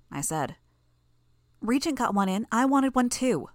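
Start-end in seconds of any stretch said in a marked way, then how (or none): 1.6-3.5 put-on lightly raised, eager girl's voice; a hint of a gleeful young girl; mildly pleased, higher voice. A narrator's reading. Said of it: "she said".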